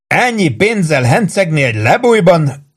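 high, drawn-out incredulous wail; helplessly amused, swooping pitch; groaning with laughter. A narrator's reading. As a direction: moan it slowly in mocking disbelief. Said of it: "nyögte Warden".

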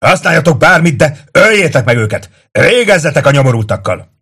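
high, loud battle orders; shouting over din. A narrator's reading. Tullius to the defenders over the din, shouting. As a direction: bellow above the noise of fighting. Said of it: "túl kiabálja a hangzavart".

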